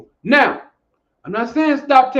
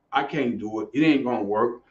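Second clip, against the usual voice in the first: low, quieter defeated mumble; mopey self-doubter; 13 semitones lower, 6 dB quieter than usual. Retold or imitated. Coach mocking a client's defeatist talk, deflated and low. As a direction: mimic a hopeless quitter, much lower. Mocking people who limit themselves.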